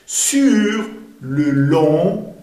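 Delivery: "Sur le long" very slow, each word drawn out; word-by-word model of "Sur le long"; pronunciation demo. Shown as a demonstration. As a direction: stretch "Sur le long", ending nasal. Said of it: "hagamos la pronunciación".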